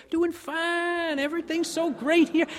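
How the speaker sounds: high, cheery, chatty visitor voice; Texan cowboy. Retold or imitated. Cowboy answering the New Yorkers' greeting, high cheery gush. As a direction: gush like a happy tourist with no idea it's a throwaway greeting. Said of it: "he goes".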